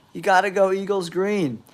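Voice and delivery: ordinary talk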